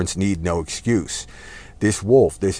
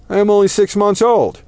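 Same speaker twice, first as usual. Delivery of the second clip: pleading lamb; 11 semitones higher, 4 dB louder than usual. Acted.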